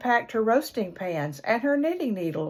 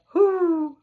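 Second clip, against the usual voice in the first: owl hoot; 9 semitones higher, 3 dB louder than usual. A narrator's reading.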